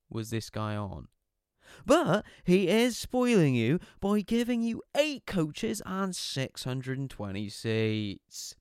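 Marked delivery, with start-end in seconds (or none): 1.8-8.5 high, far-off mock PA announcement; sarcastic train guard over the tannoy; sarcastic train announcer. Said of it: "use the P A system to practice his stand-up comedy".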